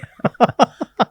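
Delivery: high, loud burst of laughter; real laugh. Real, not acted. Swami laughing at the host's cheeky request, loud high laugh. Host teases about bhakti again.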